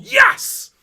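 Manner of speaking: a huge, high shout of Yes!; joyful yell. Retold or imitated. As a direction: yell it out at work like the team just scored. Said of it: "and I yelled".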